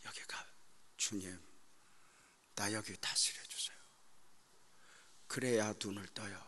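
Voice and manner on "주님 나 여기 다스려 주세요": soft whispered plea to God; whispered prayer. Retold or imitated. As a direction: breathe the prayer. Silent prayer retold. (주님께 속삭이는 기도)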